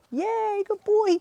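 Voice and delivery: high, loud praise for the dog; excited dog praise. Real, not acted.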